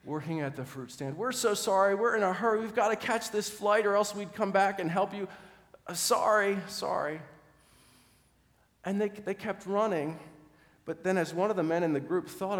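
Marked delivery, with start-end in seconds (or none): 1.2-7.2 loud, high apology shouted over the shoulder; calling back apologetically. Acted. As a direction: call out sorry while running off. Men calling back while running.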